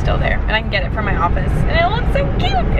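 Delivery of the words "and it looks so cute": excited squeal. Real, not acted. Gushing about the beanbag chair.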